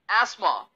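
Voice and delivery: एस्मा said as "esma", no th; correct pronunciation. Shown as a demonstration. Teacher repeating एस्मा for the class. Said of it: "जी हाँ".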